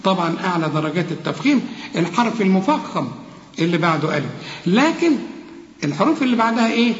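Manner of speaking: ordinary talk, nothing marked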